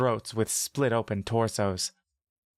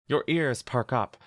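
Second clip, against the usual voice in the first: usual speaking voice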